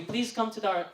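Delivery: ordinary delivery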